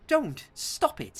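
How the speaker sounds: high, loud exasperated don't, stop it; exasperated plea. Real, not acted. Co-host venting at film studios, high exasperated plea. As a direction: beg them to just stop.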